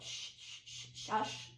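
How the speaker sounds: soft, voiceless shushing; gentle drawn-out shh; self-hushing. Retold or imitated. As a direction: hush your own racing thoughts. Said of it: "I would just say to myself".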